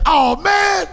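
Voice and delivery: high, loud amazed shout; amazed kids. Retold or imitated. Classmates admiring his drawing, loud amazed cry. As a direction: kids gasping at the eagle picture. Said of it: "they'd be like".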